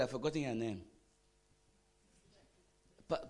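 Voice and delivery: ordinary talk, nothing marked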